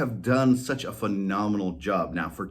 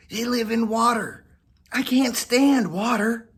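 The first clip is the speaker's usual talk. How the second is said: fussy cat voice; 13 semitones higher, 5 dB louder than usual. Acted. Whiskers complaining to Molly, higher, louder cat voice. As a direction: complain about water.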